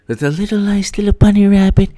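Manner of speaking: very high cooing voice; cutesy bunny talk. Retold or imitated. Storyteller greeting a bunny rabbit, high cutesy cooing. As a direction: coo as if to a tiny animal.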